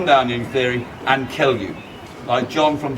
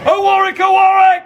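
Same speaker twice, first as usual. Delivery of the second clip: high, loud call to the crowd; rally the crowd at full voice; loud chant leading the crowd; battle cry shout; 6 dB louder than usual. Acted. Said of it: "You better be loud now".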